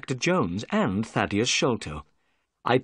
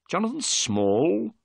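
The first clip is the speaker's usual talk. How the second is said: higher, slow questioning rise; surprised repeat; 7 semitones higher, slower than usual. Acted. Holmes to the prisoner, repeating his name in surprise. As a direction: echo the name back in surprise.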